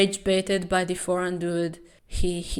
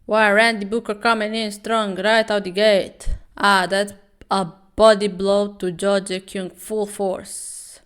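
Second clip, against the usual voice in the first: louder hyped entrance call; ring announcer; 7 dB louder than usual. Acted. Vlogger calling the champion's entrance, louder announcer style. Fight commentary.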